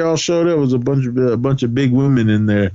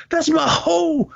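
mock indignation; slower than usual. Acting out indignant husband's comeback.

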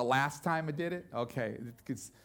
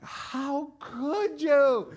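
high, slow outraged cry; 9 semitones higher, 6 dB louder, much slower than usual. Retold or imitated. Church leader caught by the prank, slow high outrage. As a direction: stretch out the betrayed disbelief. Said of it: "the person was like".

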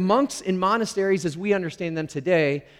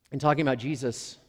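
ordinary delivery

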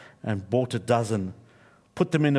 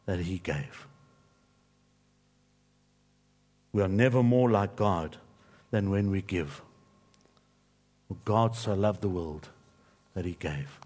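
softer, lower sermon close; quiet earnest preaching; 5 semitones lower, 4 dB quieter than usual. Acted. Pastor closing the sermon to the congregation, quiet and earnest. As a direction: draw them in gently with the last point.

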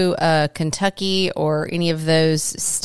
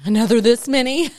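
higher, louder echo with rising end; amused disbelief; 6 semitones higher, 5 dB louder than usual. Real, not acted. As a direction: repeat it back in delighted surprise. Amused echo of child's words.

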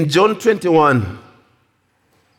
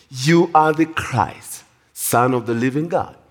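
normal talking voice

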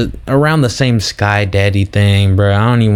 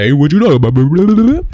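higher, louder, a nosy passer-by asking; curious stranger; 6 semitones higher, 4 dB louder than usual. Retold or imitated. Stranger walks up asking what they discuss, pitched-up curious voice. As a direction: play the stranger butting in, pitched up. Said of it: "They'll come up to you and be like".

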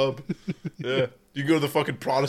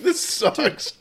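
much higher, louder whine; hammed-up moan; complaining Highlander in a mocking whine; mock whiny Scot; 14 semitones higher, 4 dB louder than usual. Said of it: "You're like".

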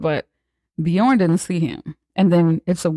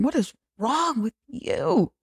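high, slow, softer mock disbelief; imitating their vibe; 9 semitones higher, 6 dB quieter, slower than usual. The guests judging Patrick, slow hushed high mock voice. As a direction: drag it out in a hushed high put-on voice. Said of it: "That's the vibe they were giving".

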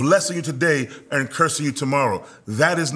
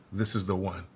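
much softer and lower, almost confiding; hushed reverent line; 7 semitones lower, 8 dB quieter, faster than usual. Acted. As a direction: drop it low like a sacred secret. God quietly choosing David.

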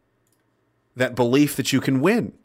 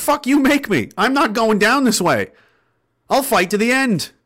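raised, louder defiant growl of a loyal friend; defiant retort; 7 semitones higher, 3 dB louder than usual. Retold or imitated. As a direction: throw it back at him, refusing to quit. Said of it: "in a much nicer tone".

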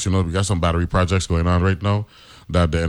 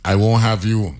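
ordinary talk, nothing marked

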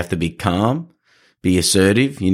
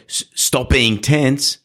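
stretched-out stop being tense, pitched up high; slow tense command; 5 semitones higher, much slower than usual. Shown as a demonstration. Trainer shows the wrong way to calm a handler, drawn out and high. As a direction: say it slowly and high, like a nagging order. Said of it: "I don't say".

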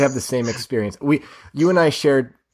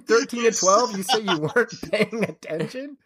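high, laughing disbelief; incredulous; 9 semitones higher, slower than usual. Real, not acted. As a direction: scoff in amused outrage. Incredulous at careless scorekeeping.

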